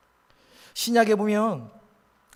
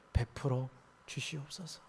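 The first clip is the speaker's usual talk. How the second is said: hushed plea; 5 semitones lower, 11 dB quieter than usual. Acted. End of acted humble prayer.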